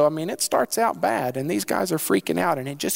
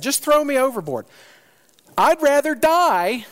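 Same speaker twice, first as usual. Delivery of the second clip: defiant Jonah; 9 semitones higher, 4 dB louder than usual. Acted. Jonah refusing to repent, loud defiant. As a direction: dig in, rather die.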